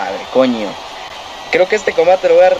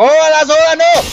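dismayed yell; 12 semitones higher, 4 dB louder than usual. Real, not acted. Player dismayed at game move.

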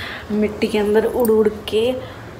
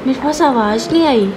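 urging louder; 6 semitones higher, 5 dB louder than usual. Real, not acted. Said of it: "थोड़ा और जोर से".

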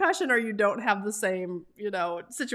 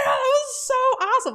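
much higher, louder gush of delight; thrilled; 5 dB louder than usual. Retold or imitated. To viewers about leaving a coaster, squealing with delight. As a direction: squeal it like a kid off a ride.

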